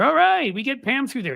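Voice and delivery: high, loud sneering mock voice of the plotters; hammed-up impression of a smug party strategist; mock Republican schemer. Mocking Republican planners' scheming thought.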